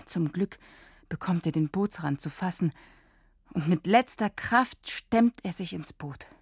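plain reading voice

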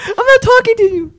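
put-on high, loud girly sulk; mock sulking girlfriend. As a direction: squeal it like a pouting girlfriend.

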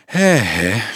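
an understated sigh of relief. Acted. Weary student arrives after his ride, sighing. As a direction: let it out as a mild weary sigh. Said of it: "zuchtte de student".

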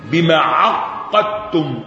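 عقدتم slowly with the qaf held doubled. Shown as a demonstration. Teacher shows students the reading, عقدتم with drawn-out doubled qaf. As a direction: lean long on the doubled ق in عقدتم. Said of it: "التجديد".

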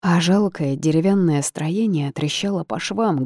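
ordinary delivery, nothing marked

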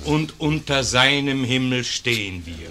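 loud, rising declaration; loud preaching cry; fervent proclamation. Acted. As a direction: lift the crowd with it. Priest rousing the assembly.

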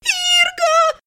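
very high squealed cry; shrieking. A narrator's reading. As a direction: shriek for your brother. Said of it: "завизжала Элиса". (пронзительный визг)

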